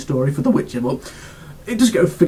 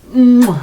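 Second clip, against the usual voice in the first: loud smacking kiss, pitched higher; chef's kiss; 9 semitones higher, 8 dB louder than usual. Real, not acted. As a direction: blow a big kiss of approval.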